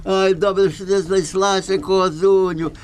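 high, sing-song peddler voice. Acted. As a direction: hawk the goat like a trickster.

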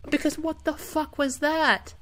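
high-pitched, exasperated disbelief; incredulous outburst. Real, not acted. Player baffled by the game's twitch, incredulous outburst. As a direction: squeal the question in disbelief.

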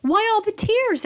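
high small voice of a caring snail; snail's voice. Acted. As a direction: ask it like a kind little snail. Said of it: "asked a snail".

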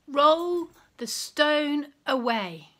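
slow firm command. Acted. Jesus commands at the tomb.

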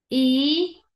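held "I" as a rising eee; long E sound. Shown as a demonstration. Teacher drilling long against short, stretched "I". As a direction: stretch "I" as ee, lifting at the end. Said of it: "Sonido largo".

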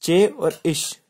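very slow, each sound set apart; teacher comparing the two sounds slowly; slow demo. Shown as a demonstration. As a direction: spell out चे और इश deliberately. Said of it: "अब जैसे चे हो गया".

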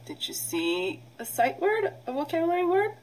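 ordinary talk

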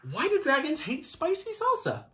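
usual speaking voice

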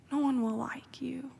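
whispered, like a secret warning; whispered warning against moving; hushed warning. Retold or imitated. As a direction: breathe it as a grave warning. Branch president's warning, hushed.

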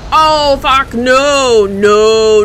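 loud, alarmed refusal; drawn-out frightened shouting; panicked yell. Real, not acted. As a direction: cry out in real panic. Sudden scare in the game.

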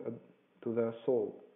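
plain reading voice

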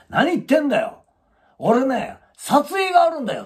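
hammed-up indignant retort; imitating an angry man. Husband snapping back at his wife, mock angry.

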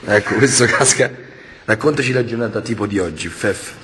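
low, hushed reporter voice; mock documentary narrator.

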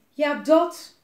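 loud angry snap; furious dad pointing at the balcony. Acted. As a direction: bark it out at the kids. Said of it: "wees boos".

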